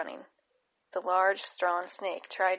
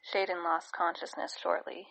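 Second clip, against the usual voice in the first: plain reading voice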